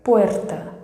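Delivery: "puerta" with a rolled Spanish R; trilled R. Shown as a demonstration. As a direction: model "puerta", tongue trembling on the R. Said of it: "É esse som que vocês precisam alcançar". ("puerta" com o R vibrado)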